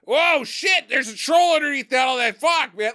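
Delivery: high, hammed-up startled shout; mock alarm. Troll finding a troll underneath snow, high mock alarm. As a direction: blurt it like an imitated scare.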